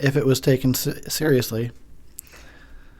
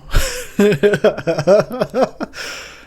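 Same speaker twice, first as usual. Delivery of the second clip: giggle; 9 semitones higher, 4 dB louder than usual. Real, not acted.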